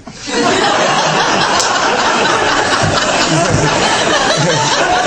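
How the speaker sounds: a burst of high breathless laughter. Real, not acted. Laughing at himself while telling the retreat story.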